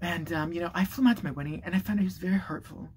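soft mock-hurt confession; wounded influencer. Woman telling viewers he was hurtful, mock wounded. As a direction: imitate her hushed, injured video voice. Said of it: "So she goes like this".

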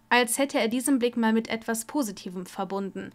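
plain reading voice

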